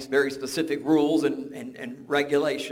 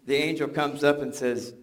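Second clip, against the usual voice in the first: ordinary talk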